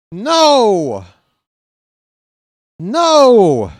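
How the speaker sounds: long, high, loud wail of no; real despairing fan's yell; anguished shout. Real, not acted. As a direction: cry out as the lead vanishes. His team loses the lead.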